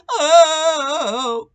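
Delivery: a sudden high, loud "Oh"; loud cry. A narrator's reading. Old Tom knocked into the mud, a loud high cry. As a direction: cry out as he falls. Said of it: "cried old Tom".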